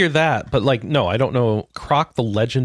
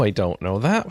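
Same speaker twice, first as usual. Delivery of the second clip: normal talking voice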